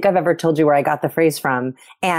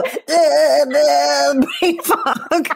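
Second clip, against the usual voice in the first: high, spaced-out mock confusion; dazed brain fog voice; 8 semitones higher, much slower than usual. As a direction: trail off like your brain just emptied. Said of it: "we just go".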